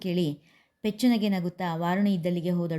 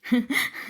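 a short airy laugh at her sister; sister's teasing little laugh; soft breathy giggle; 5 semitones higher than usual. A narrator's reading. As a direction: giggle under the breath before speaking. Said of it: "ಎಂದು ನಕ್ಕಳು ವಾರುಣಿ".

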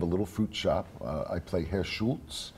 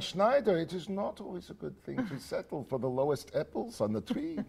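put-on higher, louder German accent; 11 semitones higher, 4 dB louder than usual. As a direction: speak as Herr Schultz in a German accent. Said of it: "give us a taste of your German accent".

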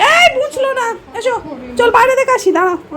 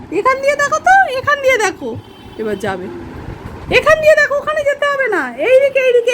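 calling to a pet; 7 semitones higher than usual. Real, not acted. Coaxing pet to go there.

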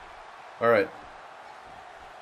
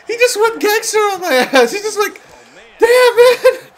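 high, laughing through the words; can barely speak for laughing; 7 dB louder than usual. Real, not acted. Laughing after the noogie.

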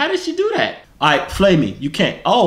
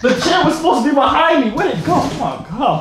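high-pitched, flustered complaint; flustered outburst; 12 semitones higher, 4 dB louder than usual. Real, not acted. Complaining about the missing chair, flustered outburst. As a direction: blurt it out in high alarm. Upset after falling, drops the accent.

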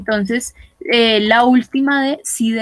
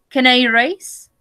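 ordinary delivery

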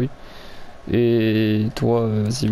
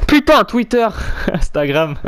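loud, high burst breaking into laughter; exasperated laughing outburst; 11 semitones higher, 4 dB louder than usual. Real, not acted. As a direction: blurt it out, then crack up at your own fumble. Laughs at own repeated fumbling.

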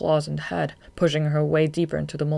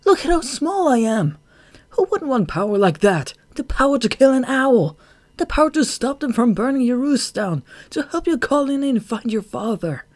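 high, loud, impassioned outburst; inward shout; 9 semitones higher, 6 dB louder than usual. A narrator's reading. Shade berates himself over wanting power, shouting inwardly. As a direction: cry it out high, line after line. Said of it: "he shouted inwardly".

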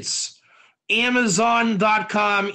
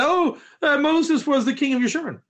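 higher, quicker offhand recall; quick, higher-pitched parroting from memory; listener recalling; 6 semitones higher, faster than usual. Acted. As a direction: play a student casually repeating the teacher. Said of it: "What did Rabbi Katz say".

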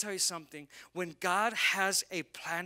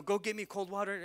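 usual speaking voice

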